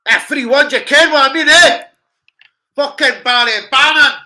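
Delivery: loud high celebration; goal celebration. Real, not acted. Fan celebrating the goal to the viewers, loud and high. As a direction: roar about the new score.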